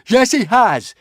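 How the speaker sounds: high, loud retort; hot arguing. A narrator's reading. Fishlegs contradicts the witch, arguing hotly. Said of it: "argued Fishlegs hotly".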